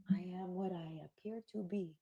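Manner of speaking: very soft, playful pronouncement; mock-mysterious hush. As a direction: murmur it like a mock-cryptic secret. Mock-mysterious answer to own question.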